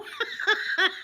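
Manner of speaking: high, loud burst of laughter; helpless laugh. Real, not acted. Laughing my head off at a funeral, loud laugh. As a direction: crack up at your own story.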